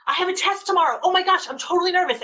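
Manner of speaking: put-on teen telling parent about a test, anxious; nervous teen. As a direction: panic a little about tomorrow's test. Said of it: "So if they say to you".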